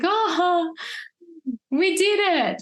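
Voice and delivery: high, breathless relief; relieved joy. Retold or imitated. Mother just after catching her baby, high relieved joy. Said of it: "that's what it felt like".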